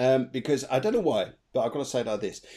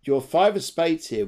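ordinary delivery

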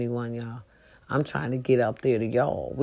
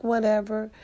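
higher dismissive brush-off; mock uninterested; a touch of eye-roll attitude; 7 semitones higher than usual. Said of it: "I'm like".

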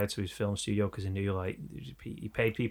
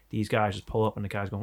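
usual speaking voice

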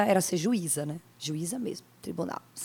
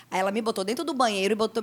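usual speaking voice

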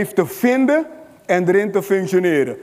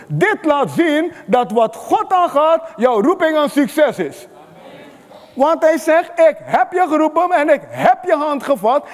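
higher and higher, pressing the promise home; building sermon climax, pitch climbing; rising preaching fervor; 6 semitones higher than usual. Acted. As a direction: preach it with mounting conviction.

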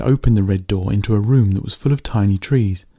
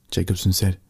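ordinary delivery

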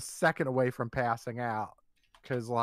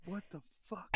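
much softer, higher mock-shocked aside; barely voiced, incredulous; hushed disbelief; 4 semitones higher, 14 dB quieter than usual. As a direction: retell a stunned reaction under your breath. Retold hushed reaction to neighbour.

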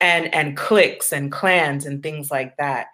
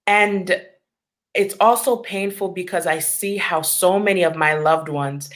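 ordinary talk, nothing marked

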